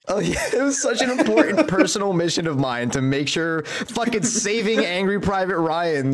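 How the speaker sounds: laughing through it. Real, not acted. Joking mission title.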